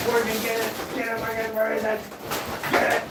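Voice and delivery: high, eager urging of a dog; excited. Real, not acted. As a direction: hype the dog up to pounce. Urging dog to catch mouse.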